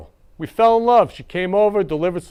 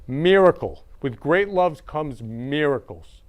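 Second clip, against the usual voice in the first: usual speaking voice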